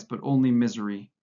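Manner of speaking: ordinary delivery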